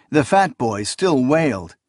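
usual speaking voice

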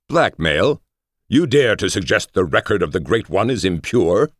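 high-pitched lordly indignation; mock outrage. A narrator's reading. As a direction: act grandly affronted at the insult. Lord feigns outrage at blackmail.

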